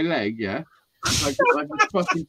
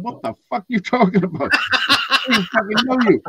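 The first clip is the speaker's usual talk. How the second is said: mock outraged woman; 7 semitones higher, 4 dB louder than usual. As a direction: imitate the shocked stranger's outrage, half laughing. Woman refuses stranger's odd request.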